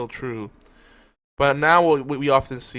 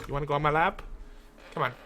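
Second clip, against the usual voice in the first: cat talk; 3 semitones higher, 5 dB quieter, much faster than usual. Real, not acted. Talking to cat.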